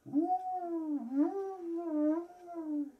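very high, loud hooting cry; owl hoot. A narrator's reading. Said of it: "par trois fois cria".

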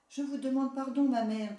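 meek daughter, lightly voiced. A narrator's reading. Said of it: "dit cette pauvre fille".

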